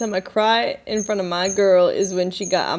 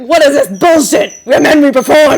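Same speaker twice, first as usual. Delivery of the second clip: higher, louder mock bro bellow; hammed-up macho; 6 semitones higher, 9 dB louder than usual. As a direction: ham up the tough-guy motto. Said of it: "It's like".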